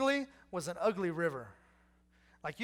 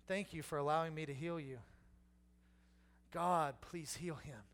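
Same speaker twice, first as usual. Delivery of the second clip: soft, gentle prayer; 4 semitones lower, 5 dB quieter than usual. Pretend. Elisha as Naaman imagined.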